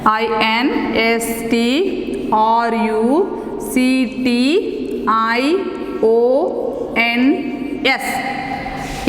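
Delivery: letters spelled out slowly one by one; slow spelling. Shown as a demonstration. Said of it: "Software means instructions".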